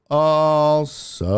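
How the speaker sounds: "also" held long on a level note; stalling on a long, level "also"; drawn-out "also". Real, not acted. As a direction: stretch out both syllables of "also". Repeats the word until drawn out.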